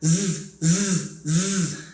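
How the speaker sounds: "Z" as a voiced buzz, not the letter name; short voiced "z" buzzes; isolated Z sound. Shown as a demonstration. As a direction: buzz each "z" separately. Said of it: "Now the Z sound".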